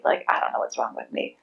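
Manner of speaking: put-on low whispered child's inner voice; son's hurt whisper. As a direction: whisper it like a hurt, confused kid. Voicing son's hurt inner thought.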